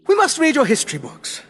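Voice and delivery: high, loud, bright eagerness. A narrator's reading. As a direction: chirp it with cheerful interest. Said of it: "said the doctor brightly".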